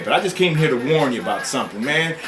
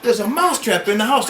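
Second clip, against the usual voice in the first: rising alarm; 10 semitones higher, 4 dB louder than usual. Acted. Repeated warning rising in pitch.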